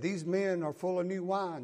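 normal talking voice

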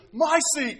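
put-on loud, high child's shout; child claiming seat. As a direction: yell it like a kid grabbing a chair. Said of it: "she goes".

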